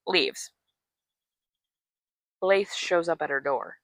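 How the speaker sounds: ordinary talk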